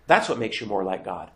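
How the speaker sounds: normal talking voice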